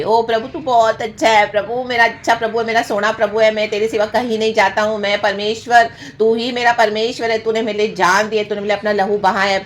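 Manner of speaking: butter up the Lord in a high, pleading run; mock buttering-up. Preacher mimicking believers flattering God to get favours, mock buttering-up. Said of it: "प्रभु को बहुत buttering भी करेंगे".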